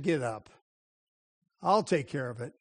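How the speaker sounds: low, soft, controlled order; quiet command. Retold or imitated. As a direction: take charge quietly, pitch dropped. Jezebel takes charge, retold.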